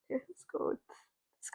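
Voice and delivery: breathy whispered aside. Real, not acted. Muttering to herself over the drawing, whispered. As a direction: whisper it to yourself while picking a color.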